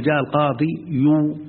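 القاضي يو with a final يو tacked on; mock-wrong القاضي يو said slowly; wrong ending demonstrated. Shown as a demonstration. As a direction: show the mistake, stretching القاضي يو. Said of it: "لا تقل".

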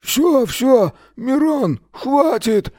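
high strained giving-up voice; muffled, face in the grass. A narrator's reading. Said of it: "сказал приглушённо плафон".

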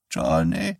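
put-on low, faint voice of a dying old woman; frail old mother. Dying mother calls her son from the bed, faintly. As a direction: call weakly from the sickbed.

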